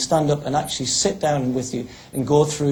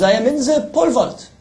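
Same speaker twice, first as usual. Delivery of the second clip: higher mock French accent; 10 semitones higher, 4 dB louder than usual. Frenchman telling the guard his event, higher mock French accent. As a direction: answer proudly in a put-on French accent. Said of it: "pretend I am a French athlete".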